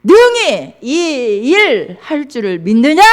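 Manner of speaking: slow, loud, high-pitched demanding question; forceful challenge. Acted. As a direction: draw out each phrase and ring it out. Repeats challenge after weak answer. (크고 느리게 힘주어 묻듯)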